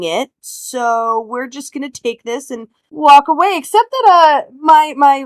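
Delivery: emphatic, stalling, over-formal excuse; officious boy detective. Acted. Boy making excuses to officer about the note, officious. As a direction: talk your way out with halting self-importance. Player speaks as Blaine to officer.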